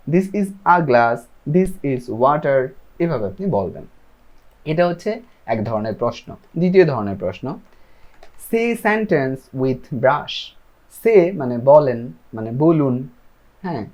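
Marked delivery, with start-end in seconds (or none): 0.1-2.7 each word slow and separate; slow model answer. Shown as a demonstration. Teacher models answer sentence. 8.5-10.5 each word slow and separate; slow model sentence. Shown as a demonstration. Teacher models English instruction.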